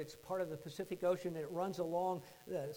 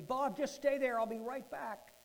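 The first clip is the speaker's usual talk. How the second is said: high, loud calling out; distant yell; 7 semitones higher, 7 dB louder than usual. Retold or imitated. Father yelling to his son from afar, loud call. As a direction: call across a wide distance. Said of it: "my father in the distance yelling to me".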